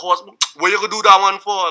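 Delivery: angry Trinidadian outburst, high and loud; Trinidadian accent. Retold or imitated. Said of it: "Like, I'll just be Trinidadian".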